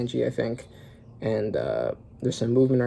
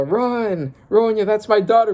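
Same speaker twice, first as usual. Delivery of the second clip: father calling out; 8 semitones higher than usual. Acted. Father cries out for daughter.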